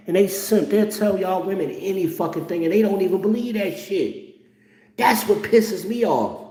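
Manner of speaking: high, worked-up rant; heated rant. Real, not acted. Ranting at old men who lie, worked up. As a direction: get fired up and indignant.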